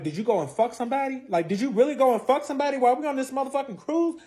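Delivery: high, incredulous questioning; acted indignant interrogation; retold accusation. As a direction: demand an answer, voice climbing. Acted accusation during argument.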